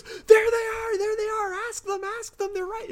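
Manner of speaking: very high, crying shout; panicked scream. Retold or imitated. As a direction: point and scream in terror. Duane Barry screams at the agents.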